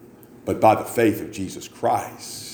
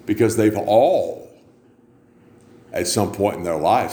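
normal talking voice

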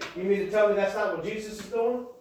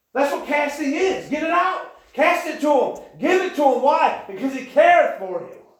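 raised, ringing preacher's call; preaching at full force; 4 semitones higher than usual. Acted. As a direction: push it out to the congregation.

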